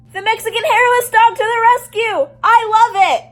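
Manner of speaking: loud, high delighted cheering; excited cheer. Real, not acted. Vlogger cheering the dog's rescue, loud and delighted.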